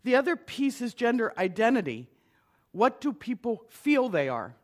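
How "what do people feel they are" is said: usual speaking voice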